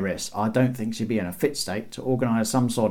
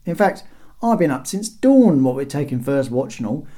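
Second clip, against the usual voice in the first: raised, chatty brush-off; cheeky guard; 7 semitones higher, 6 dB louder than usual. A narrator's reading. Guard wanting breakfast after first watch, impertinent brush-off. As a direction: call down impertinently from the wall. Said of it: "it was verging on impertinence".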